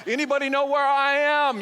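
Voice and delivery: high, loud call of "anybody know where I am?"; a lost teenager yelling for help; desperate yell. Retold or imitated. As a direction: shout it out into the woods, lost. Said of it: "So I'm screaming".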